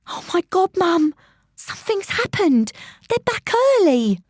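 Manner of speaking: put-on high, breathless girl's panic; a frightened girl calling for mum; panicked girl. A narrator's reading. Narrator voices panicking girl.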